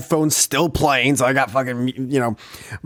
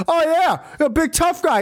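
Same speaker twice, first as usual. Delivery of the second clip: high sneering jeer, far higher than his own voice; mocking taunt; 13 semitones higher than usual. Old man jeering across the street, high and mocking. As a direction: make fun of him like a schoolyard bully. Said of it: "he goes".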